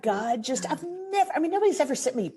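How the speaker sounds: bursting with disbelief and joy; overwhelmed excitement. Real, not acted. Overwhelmed by surprise gift.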